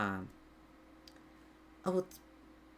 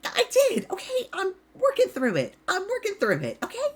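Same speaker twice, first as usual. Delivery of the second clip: much higher, defensive insistence; defensive Chloe; 11 semitones higher than usual. Acted. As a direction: push back, cornered but stubborn. Chloe snaps back at questioning.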